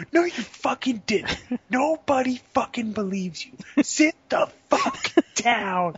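very high, hammed-up exasperation; squawking comic outburst, half laughing by the end; mock fed-up snapping. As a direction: imitate someone losing it at a braggart, slowing hard at the end. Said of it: "somebody's just had enough".

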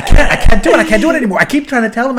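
exasperated dialogue coach. Acted. Dialogue coach giving up on the actor, exasperated. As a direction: play a coach at wit's end. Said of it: "the dialogue coach left halfway through".

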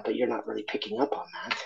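whispered aside. Real, not acted.

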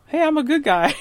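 lightly mocking cheerful nice-guy voice. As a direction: play the harmless nice guy, tongue in cheek. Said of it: "not in the".

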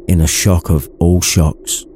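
ordinary delivery

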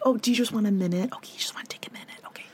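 imitating a soft whisper to a small child; soothing whisper. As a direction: hush it like calming a toddler. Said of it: "how I would say to Charlie".

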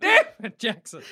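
high exasperated shout; mock angry yell. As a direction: yell after him, exasperated. Flash yelling after him.

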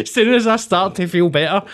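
laughing while he talks; words through real laughter. Real, not acted. Laughs at the irony.

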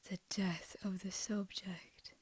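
breathy awed whisper. Real, not acted. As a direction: whisper it like a dark secret. Awed echo of a grim idea.

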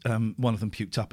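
ordinary talk, nothing marked

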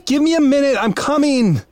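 calling out loudly from afar; shouting. Acted. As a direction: yell back to the kids, loud and high. Dad shouting from another room.

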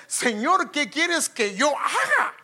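slow, high, awed plea; humble plea. Acted. Paul's question voiced again.

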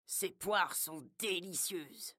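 sly tempting voice. Acted. Wolf lures pig with pears.

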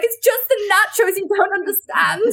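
high, mock-innocent protest; joking excuse. To friends asking why she's emotional, a high mock protest. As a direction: brush them off with a silly excuse.

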